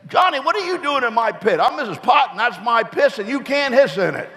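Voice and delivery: put-on high-pitched scolding old lady, a touch of laughter; Missus Pot. Neighbour snake scolding young Johnny, high and indignant. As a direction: squeak it out like a fussy neighbour.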